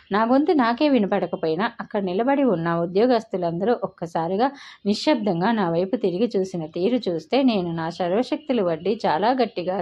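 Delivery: plain reading voice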